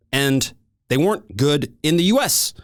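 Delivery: ordinary talk, nothing marked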